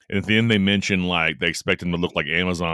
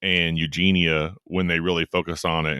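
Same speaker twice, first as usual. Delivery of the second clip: ordinary talk, nothing marked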